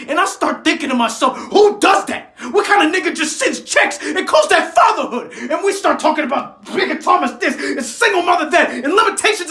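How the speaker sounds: high, very loud angry shouting; furious outburst. Acted. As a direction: shout it at full force, boiling over. Outburst at father and teacher.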